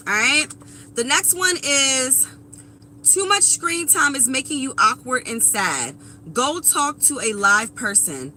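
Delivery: usual speaking voice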